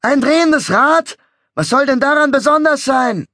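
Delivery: high, hoarse jeering shout; hoarse heckler. A narrator's reading. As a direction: bawl it from the crowd, hoarse. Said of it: "krakeelte mit heiserer Stimme".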